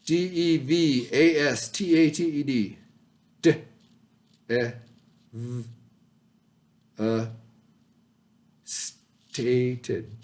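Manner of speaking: sounding out devastated haltingly like a struggling student; mock struggling reader. Shown as a demonstration. Teacher playing a struggling student sounding out the word. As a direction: stumble through D E V A S T A T E D as a child would. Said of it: "Why don't you guys try and read that?".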